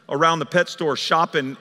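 ordinary talk, nothing marked

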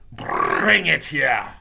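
loud, slow shouted order; imperious witch's shout; Grand High Witch. Acted. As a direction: command it, dragging out the first word. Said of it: "shouted the Grand High Witch".

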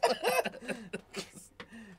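a real, amused burst of laughter. Real, not acted. Friend reacts to the comedy clip, laughing. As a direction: laugh freely at the joke.

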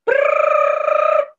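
very high, loud buzzing brrrr; alarm clock ring. A narrator's reading. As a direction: rattle it like a shrill alarm. Alarm clock ringing in story.

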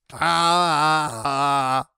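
loud high yelp of pain; pained yell. Real, not acted. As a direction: cry out as you get hit. Hit in game.

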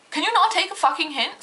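fed up with a customer who won't listen; exasperated. Retold or imitated. Cashier fed up with the customer, exasperated.

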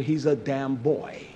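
usual speaking voice

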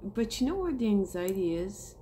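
ordinary talk, nothing marked

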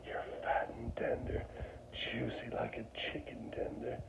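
breathy mock whisper of sweet nothings. Pig whispering sweet nothings into owner's ear, whispered. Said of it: "whispering sweet nothings into my ear".

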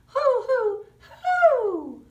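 high, loud hooting; sung owl hoo for the children. Acted. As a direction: hoot it like a baby owl. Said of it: "he said he would love to".

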